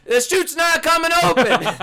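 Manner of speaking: mock panic, laughing. Imagining the instructor yelling the chute failed. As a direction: cry it out in hammed-up terror. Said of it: "He's like".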